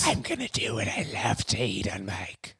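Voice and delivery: eerie whispered voice. Acted. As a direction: breathe the words like a ghost.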